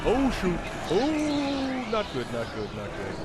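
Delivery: being chased. Real, not acted. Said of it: "this is very stressful".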